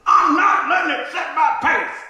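loud, slow, indignant insistence; indignant shout. Retold or imitated. Friend shouting back that he's fine, indignant and loud. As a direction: hammer each word out slowly.

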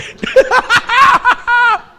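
loud, high-pitched burst of laughter; real big laugh. Real, not acted. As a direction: crack up helplessly at your own story. Cracks up at own story.

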